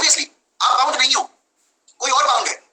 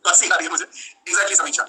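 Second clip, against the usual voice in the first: normal talking voice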